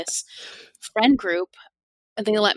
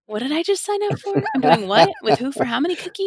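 much higher, incredulous rapid questions; baffled, half-laughing at what you just signed up for; bewildered disbelief; 8 semitones higher, 5 dB louder than usual. Retold or imitated. Retelling baffled self after agreeing.

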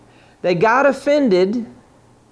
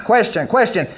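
ordinary talk, nothing marked

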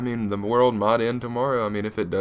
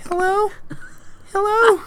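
put-on squeaky high child saying hello; little kid voice. Mocking the ten-year-old prankster, high childish hello. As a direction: go way up like a small kid. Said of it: "they sound like maybe ten".

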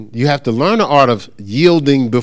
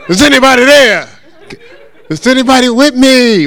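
loud, high mock-desperate call; calling into silence; 9 semitones higher, 7 dB louder than usual. Speaker calling to unseen listeners through the silence, loud plea. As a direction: holler out for any response. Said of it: "you gotta get through the silence".

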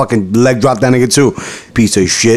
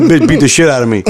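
usual speaking voice

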